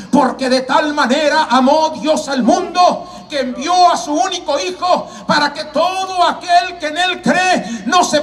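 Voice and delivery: high, driving scripture proclamation; preaching at full force. Acted. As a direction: fire off verses like a fervent evangelist.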